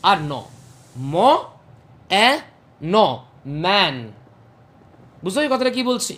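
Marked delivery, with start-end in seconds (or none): 1.1-4.0 ম, এ, ন spaced, then ম্যান blended; sounds then word. Shown as a demonstration. Teacher splitting and blending the word for students. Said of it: "speech sound কোনগুলো আছে ভেবে দেখ".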